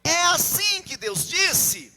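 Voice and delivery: loud, high, slowly drawn-out challenge; serpent questioning Eve. Acted. Serpent asks the woman about God's word, loud drawn-out questioning. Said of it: "O que que a serpente falou à mulher?".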